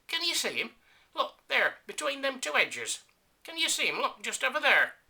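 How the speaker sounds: very high, loud urgent lieutenant; high squeaky lieutenant pointing it out. Acted. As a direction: insist loudly in a high squeaky voice. Lieutenant urging prince to look.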